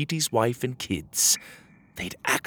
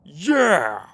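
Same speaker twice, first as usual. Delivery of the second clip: other guard agreeing; 7 semitones higher, 6 dB louder than usual. Acted. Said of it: "said the other guard".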